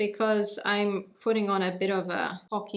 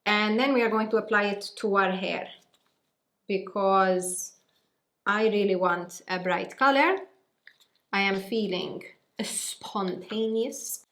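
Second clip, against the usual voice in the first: Maltese accent. Shown as a demonstration. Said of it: "That is the accent that you're gonna be greeted with".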